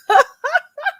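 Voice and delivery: a last high laugh at her own joke; high giggle. Real, not acted.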